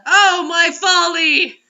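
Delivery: slow, loud, drawn-out tragic wail; melodramatic lament. Acted. Co-host mourns the story's folly in a slow, loud wail. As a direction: ham it up like a stage tragedian.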